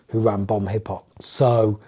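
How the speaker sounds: usual speaking voice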